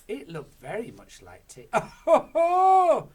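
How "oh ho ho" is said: very high, very loud triumphant laughter; Grandma crows with laughter; gleeful cry. Acted. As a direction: crow with glee. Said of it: "she cried".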